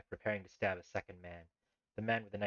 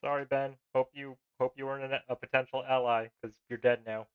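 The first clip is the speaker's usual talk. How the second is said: mock apology; 6 semitones higher, 9 dB louder than usual. Real, not acted. Mocking the man he shot.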